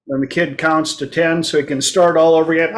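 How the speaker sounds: normal talking voice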